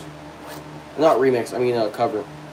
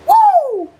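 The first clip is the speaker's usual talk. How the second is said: very high, loud whoop; piercing playful whoop; falsetto woo; 6 dB louder than usual. Pretend. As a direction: hoot a falsetto woo that slides down.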